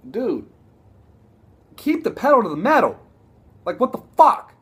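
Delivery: loud, high-pitched frustrated demand; exasperated outburst. Real, not acted. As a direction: yell at the coach in frustration. Pleading with the team's coach.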